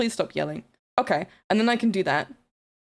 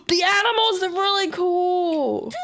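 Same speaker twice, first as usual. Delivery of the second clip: excited squeal; 9 semitones higher, slower than usual. Real, not acted. Gasping excited reaction.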